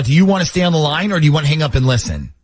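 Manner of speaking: usual speaking voice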